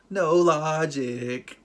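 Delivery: loud, very drawn-out mock complaint; exasperated verdict. Host judging the plot's contradiction, exasperated drawl. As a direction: stretch both words out slowly and loudly.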